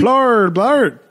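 high, hammed-up Midwestern dad; Midwestern accent. Dad repeating the movie title, Midwestern accent. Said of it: "you do a great, uh, Midwestern accent".

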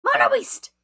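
high, loud cry of discovery; excited shout. Real, not acted. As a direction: yell it out like you just spotted your prey. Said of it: "why I got so excited about that".

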